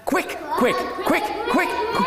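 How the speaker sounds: high, squeaky duckling chirps; high-pitched duckling cries; excited little duck. Acted. Fifth duck says quick instead.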